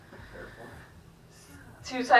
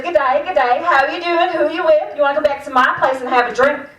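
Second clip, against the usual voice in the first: higher, louder Australian pick-up patter; Australian accent; 5 semitones higher, 5 dB louder than usual. Acted. Comic voices her Australian date chatting her up, Australian accent. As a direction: put on a loud Aussie come-on. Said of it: "if I want that Australian accent".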